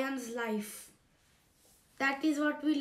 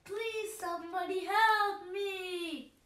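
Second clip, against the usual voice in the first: higher, tearful plea; desperate plea; 5 semitones higher than usual. Acted. Trapped lion pleads tearfully for anyone to help. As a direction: beg with a crying edge.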